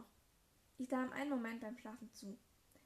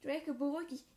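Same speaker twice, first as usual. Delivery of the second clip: raised plea; 4 semitones higher, 6 dB louder than usual. Acted. Liz pleads again.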